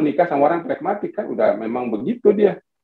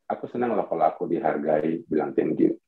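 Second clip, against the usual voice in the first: low, soft repeated request; assertive wife; 10 semitones lower, 8 dB quieter than usual. Acted. Wife repeating what pleases her, soft, low assertiveness. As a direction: say it low and softly, without pushing. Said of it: "Tapi diulangnya lagi".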